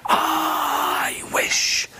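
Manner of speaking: long breathed-out whisper; magical wishing. Acted. Wife making a magic wish, long hushed whisper. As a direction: stretch the first word into an airy whisper.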